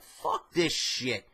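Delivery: drawn out, fed-up muttering; slow fed-up grumble; slow disgusted mutter. Retold or imitated. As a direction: spell out your disgust slowly. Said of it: "I'm like".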